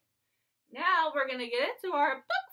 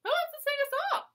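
excited; 11 semitones higher, 3 dB quieter, much faster than usual. Acted. Teacher rallying kids to sing.

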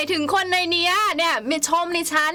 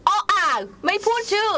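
teasing; 7 semitones higher than usual. Real, not acted. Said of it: "ไม่พูดชื่อไม่พูดชื่อ ไม่พูดชื่อ".

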